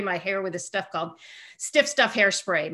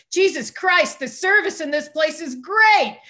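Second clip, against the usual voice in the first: loud, slow, raised-pitch exclamation; Jack Nicholson; 7 semitones higher, 6 dB louder than usual. Retold or imitated. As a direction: exclaim it to the whole room.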